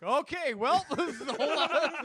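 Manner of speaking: high, laughing through the words. Retold or imitated. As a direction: laugh while quoting the panic. Retold quote, laughing.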